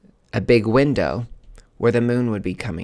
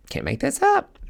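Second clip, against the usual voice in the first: jumps way higher in mock amazement; stunned disbelief. Telling Anya about the grave beside her, high incredulous squeak. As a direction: squeak it out, floored by the coincidence.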